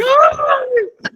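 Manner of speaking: sudden high delighted squeal; delighted cry. Retold or imitated. Mother delighting in her baby, a high joyful cry. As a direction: squeal with joy like a thrilled mother. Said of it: "la madre se pone contenta y dice".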